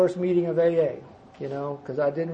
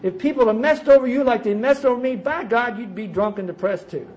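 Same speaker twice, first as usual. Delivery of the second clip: self-pitying inner rant; 5 semitones higher than usual. Retold or imitated. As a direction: whine at the world like it owes you. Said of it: "And I thought".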